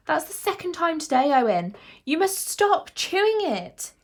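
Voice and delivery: loud, high telling-off; scolding head teacher. A narrator's reading. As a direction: scold a pupil, stretching out the final order.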